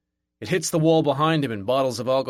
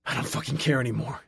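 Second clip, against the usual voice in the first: plain reading voice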